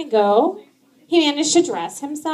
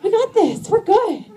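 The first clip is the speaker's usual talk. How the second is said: mock cheerful reassurance; 10 semitones higher than usual.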